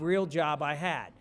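ordinary talk

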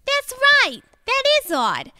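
high, perky realisation; sudden puzzlement. Acted. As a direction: pipe up high, struck by the oddity. Girlfriend suddenly agrees.

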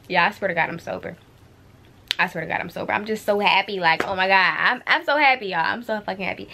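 ordinary talk, nothing marked

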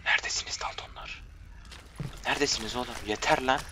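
hushed whisper; quiet searching whisper. Real, not acted. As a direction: whisper it while sneaking. Sneaking, calling for hidden enemies.

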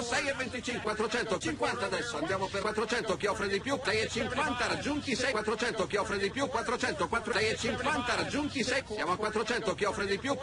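rapid rhythmic bid calling; italian auctioneer. Acted. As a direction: rattle off the bids like an auctioneer. Auctioneer calls the bids.

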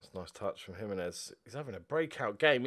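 ordinary delivery

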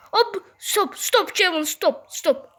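loud, high repeated commands; frantic halting shouts. Real, not acted. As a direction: shout to halt a friend mid-game. Frantically telling Kevin to stop. (telaşla bağırarak durdurma)

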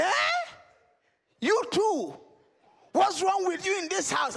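high, disbelieving uncle; shocked uncle voice. Acted. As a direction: sound appalled at another thief. Uncle catches narrator stealing.

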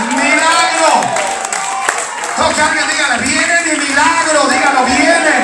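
loud revival-preacher delivery; fervent, shouted testimony and exhortation; full-force preaching. Real, not acted. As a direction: drive the story home to the whole church.

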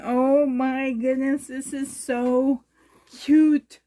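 ordinary delivery

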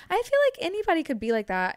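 high, fast and loud; excited burst. Real, not acted. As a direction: blurt it out excitedly. Sudden burst in lively talk.

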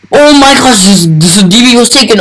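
high burst of starstruck excitement; excited. Real, not acted. Fan confirming the YouTuber's account, excited. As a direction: blurt it out high, stumbling with excitement.

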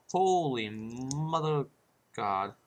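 slow drawn-out amazement; awestruck. Real, not acted. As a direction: stretch it out in awe. Shocked at the view.

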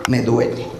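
sudden low groaned me duele; whiny ache. Acted. Patient whining to doctor, low and pained. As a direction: drop the voice low and soft on me duele.

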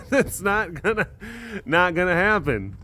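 laughing it off. Real, not acted. Refusing to name the rhino after mom, laughing.

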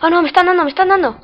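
high, loud alarmed yelling; panicked shouting. Real, not acted. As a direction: yell in sudden panic. Under fire in game, panicking.

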